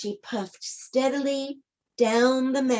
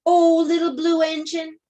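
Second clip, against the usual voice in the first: higher, louder plea; pleading cry; 5 semitones higher, 5 dB louder than usual. A narrator's reading. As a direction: cry out in hope. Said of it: "cried the dolls and toys".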